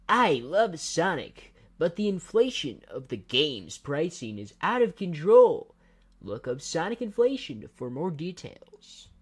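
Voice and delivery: high, slow cartoon hedgehog voice, pitched well up; hammed-up Sonic, dragging every word out high; Sonic impression. Streamer reading a joke to chat, doing a high, slow Sonic voice. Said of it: "let me try to do my best Sonic impression".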